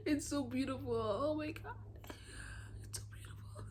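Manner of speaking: soft, whispery and overcome; close to crying. Real, not acted. Reader moved by a romance line, choked up and hushed. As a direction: let it dissolve into a tearful whisper. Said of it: "I'm not gonna cry".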